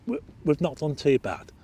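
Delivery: normal talking voice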